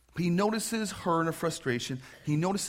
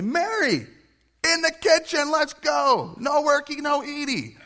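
much higher, loud nagging sister voice; bossy Martha; 12 semitones higher, 8 dB louder than usual. Retold or imitated. Martha ordering Mary into the kitchen in a high loud bossy voice. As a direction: shout orders at your lazy sister. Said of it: "Martha to go to Mary and say".

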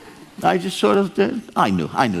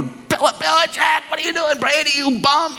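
high-pitched half-shouted rant at the screen; mock-berate the coach and quarterback; mock yell at the TV; 13 semitones higher than usual. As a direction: fan heckling the television, high mock yell. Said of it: "screaming at the T V".

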